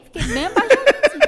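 laughing loudly and fast. Real, not acted. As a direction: tell it while cracking up. Hiding in stalled car, laughing.

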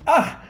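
high sharp cry of dismay; pained yelp. Real, not acted. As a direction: yelp at the bad news. Takes poison damage.

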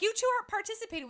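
high, loud, quick imitation of a telling-off; hammed-up scolding; mock-mad scolding. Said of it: "Dave would get mad at us".

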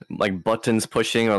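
usual speaking voice

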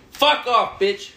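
yelling. Real, not acted. Friend yelling back playfully.

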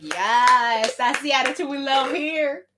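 hammed-up delight, high and loud; gleeful approval. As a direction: crow it like a twisted cheerleader. Poe gleefully approves Maya's misery.

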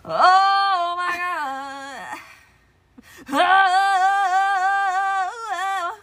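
very slow, high "oh my God"; drawn-out gasp. Real, not acted. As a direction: stretch it out in shock and relief. Strip pulled off, pain reaction.

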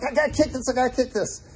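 anxious inner voice before the kick; nervous. Retold or imitated. Young player near the goal, mildly nervous mock panic. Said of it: "I was nervous".

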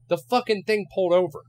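high, loud exasperated cry; shocked shout at the stepmom; retold outburst. Yelling at stepmom after car stops.

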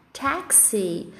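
"Taxi" with an open E in the first syllable; English pronunciation. Shown as a demonstration. Teacher repeats the English word for students, English pronunciation. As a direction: softly model "Taxi" as TEK-see, final I sounded. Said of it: "A gente vai fazer esse som de E no A".

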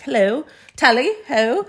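a hint of mock British accent. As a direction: put on a cheery mock-British hello. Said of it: "British man's like".

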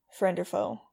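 plain reading voice

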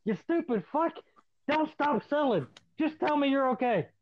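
high-pitched exasperated plea, retold; laughing scolding, retold. Wrestler face-down on his opponent, secretly pleading, retold exasperated scolding. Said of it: "laughing, going".

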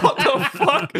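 high, loud, laughing disbelief; incredulous outburst through laughter; mock bafflement. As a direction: squeal it in comic confusion. Acts band's baffled reaction.

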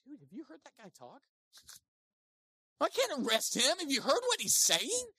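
casual, high amazed voice; awestruck officers. Retold or imitated. Officers excusing themselves to Pharisees, high and amazed. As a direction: gush like a dazzled guard. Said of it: "And they're like".

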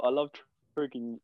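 ordinary delivery

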